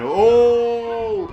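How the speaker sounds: high, loud startled oh; surprised oh. Real, not acted. Commentator caught off guard by a shocker, high startled oh. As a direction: gasp at the shocker.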